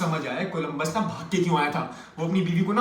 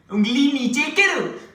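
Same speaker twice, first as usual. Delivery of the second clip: high, slow, stretched-out order; mock commanding woman; 7 semitones higher, 4 dB louder, much slower than usual. Woman halting man's argument, high mock command. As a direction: mimic her scandalised sharp command. तब सामने से आवाज आएगी.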